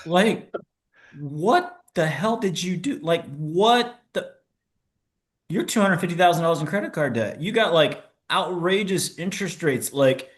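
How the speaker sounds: playing the horrified wife; shocked wife. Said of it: "she's like".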